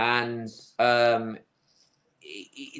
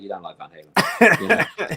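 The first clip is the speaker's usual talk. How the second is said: mock scoff; 7 semitones lower, 4 dB louder than usual. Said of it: "I'm like".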